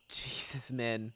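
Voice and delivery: whispered under the breath; stunned hushed Jesus, Min; shocked whisper. Real, not acted. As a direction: breathe it in disbelief. Min plans to kill her father.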